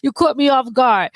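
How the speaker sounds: laughing through the words. Real, not acted. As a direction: talk while still laughing. Laughing at co-host's joke.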